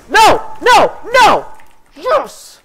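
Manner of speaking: high, loud bursts of excitement; hyped shouting. Real, not acted. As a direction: shout each word, totally hyped. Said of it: "I'm really hyped right now".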